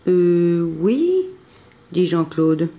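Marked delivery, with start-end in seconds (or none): 0.1-1.3 unconvinced. A narrator's reading. Jean-Claude doubts Billy's tree plan, unconvinced. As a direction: agree reluctantly, unsure of the plan. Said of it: "pas très convaincu".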